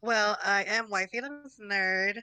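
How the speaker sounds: normal talking voice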